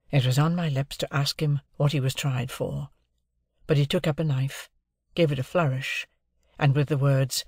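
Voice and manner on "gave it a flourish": usual speaking voice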